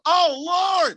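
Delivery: exasperated cry. Real, not acted. Recalling brutal summer heat.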